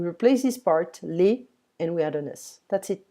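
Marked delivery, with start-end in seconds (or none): none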